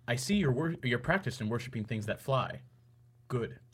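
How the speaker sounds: quiet, measured villain quote; low-key imitation of a cool conqueror; General Zod. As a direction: say it softly, with villainous poise. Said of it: "And he says".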